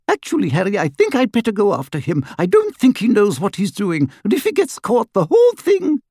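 put-on high, rushed, anxious girl; very fast. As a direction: rattle it off fast and worried. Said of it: "very fast".

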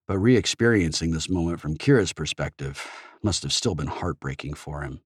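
ordinary delivery, nothing marked

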